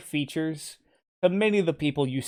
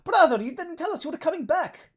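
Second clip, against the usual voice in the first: high twin voice; 12 semitones higher than usual. Acted.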